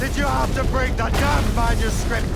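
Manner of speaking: furious, hurling the question at him; angry shout. Acted. Shay shouting at Liam over the broken box, angry.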